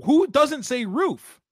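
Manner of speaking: high, drawn-out disbelief; incredulous. Real, not acted. Host baffled by co-host's roof claim, incredulous.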